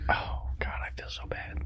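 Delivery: guilty whispered aside; embarrassed whisper. Real, not acted. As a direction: breathe it so only the table hears.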